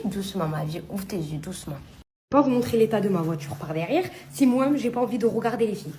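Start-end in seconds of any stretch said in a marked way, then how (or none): 0.0-1.8 quiet tender coaxing; soft soothing. Real, not acted. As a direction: gently soothe a sleepy pet. Soothing waking dog.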